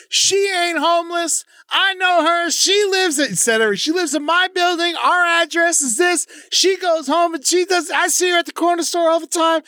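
retold tattletale lady, pitched high; mock snitching lady. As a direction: squeal it like the nosy neighbour. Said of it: "She's like".

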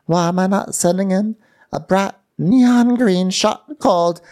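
high, far-off put-on voice; imitating Tasha talking to camera; mock Tasha. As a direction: gush it like a silly reality star. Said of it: "then she tells us".

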